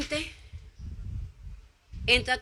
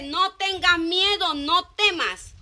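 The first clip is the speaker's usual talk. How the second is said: reassuring; 4 semitones higher, much slower than usual. Acted. God's reassuring words quoted.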